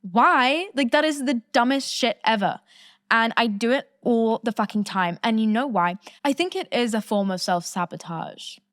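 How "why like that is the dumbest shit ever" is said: loud, sharp, higher "Why?"; a loud exasperated cry; exasperated outburst. Real, not acted. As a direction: throw the question out in frustration. Exasperated outburst at own habit.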